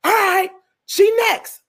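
high, drawn-out dismissive summons; real mean. Retold or imitated. Producer calling the next audition, mean. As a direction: play a mean casting boss, slow and high. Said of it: "real mean".

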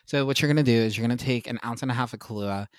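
normal talking voice

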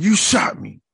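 high, indignant mock outcry; hammed-up wounded accusation. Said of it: "He started going [name] on his ass".

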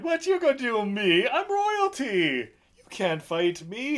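high, slow, haughty mock whine; whiny royal brat. Nephew refusing the duel, in a high haughty whine. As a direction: whine it like a spoiled prince hiding behind his title. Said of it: "And you're like".

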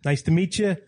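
put-on New York-accented greeting; Long Island accent. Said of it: "Everybody there talks like this".

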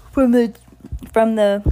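sigh, then a real laugh breaks through; the reader loses it and laughs; breaking up laughing. Real, not acted. Reader breaks up mid-sentence.

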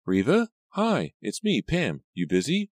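high, thin phone caller; thin-voiced Pam. A narrator's reading. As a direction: pitch it up into a thin girlish phone voice. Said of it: "her voice thin and shaky".

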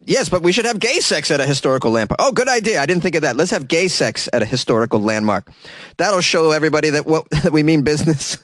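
high, eager voice of clueless activists; mock activist voices. Activists excitedly pitching a stunt to each other, high mock voice. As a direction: play it like a naive brainstorm, pitched well up. Acting out activists' silly dialogue.

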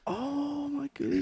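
put-on very high, slow, soft Chinese accent; mock Chinese accent. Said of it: "we do such a racist Chinese guy".

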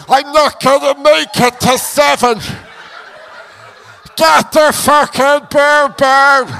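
slow, drawn-out hammed-up pleading; mock panic. Downed boxer begging the referee for help, mock-dying wail. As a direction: wail as if life is slipping away.

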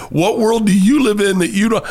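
high incredulous retort. Retold or imitated. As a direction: push it up in disbelief. Retold argument about fame.

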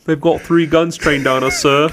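high, loud British report to a superior; excitable British military voice; British naval officer. Acted. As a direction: sound the alarm as a hammed-up British officer. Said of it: "There's a couple different captains".

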